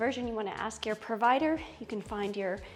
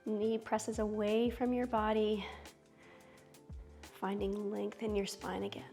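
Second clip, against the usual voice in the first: soothing yoga teacher; 6 dB quieter, slower than usual. Acted. Guides yoga poses slowly.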